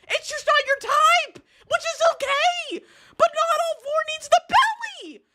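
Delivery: very high, squeaky incredulous questioning; real high-pitched exasperated lecturing; exasperated interrogation. Real, not acted. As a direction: argue it out in a strained high squeal.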